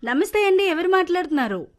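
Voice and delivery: high, loud phone-line check; calling out. Real, not acted. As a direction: raise your voice to reach the caller. Host calling to caller on bad line.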